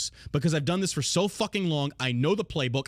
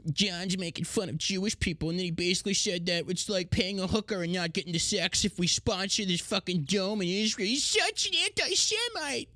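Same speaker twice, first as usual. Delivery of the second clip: mock outraged critic; 3 semitones higher than usual. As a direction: ham up a scandalised critic.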